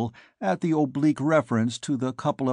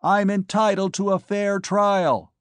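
much higher, slow yell; 8 semitones higher, 4 dB louder than usual. A narrator's reading. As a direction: keep yelling, slowly. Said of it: "I yelled back at her".

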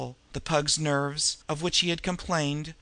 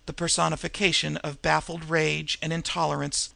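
plain reading voice

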